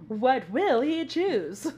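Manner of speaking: slow, loud, drawn-out announcer voice; dramatic announcer. Pretend. Mock announcer teasing what Grogu will choose. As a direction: build suspense like a TV cliffhanger.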